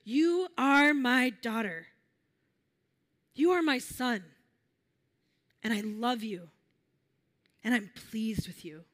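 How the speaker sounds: measured declaration with long pauses; divine voice. Acted. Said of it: "and this voice says to you".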